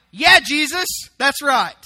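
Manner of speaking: loud, high cheer of approval; acting out a delighted crowd; excited cheering. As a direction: cheer him on like a rowdy supporter. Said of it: "the scribes are all excited".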